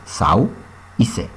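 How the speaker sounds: SAU, pausa, y CE; separado en sílabas. Shown as a demonstration. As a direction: di «sau» y «ce» como dos sílabas sueltas. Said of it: "Sauce se divide en dos sílabas". (sílaba por sílaba: «sau»... «y ce»)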